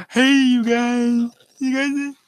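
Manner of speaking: very high, loud call to the audience; high squeaky voice. Acted. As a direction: squeal it out like a cartoon. Calling the viewers in funny voice.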